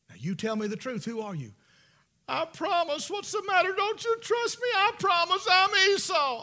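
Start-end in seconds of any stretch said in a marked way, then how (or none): 2.3-6.4 pleading liar. Acted. Jacob whines to his father that he is Esau. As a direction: sound hurt that he doubts you.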